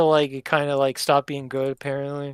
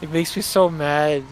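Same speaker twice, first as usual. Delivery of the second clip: usual speaking voice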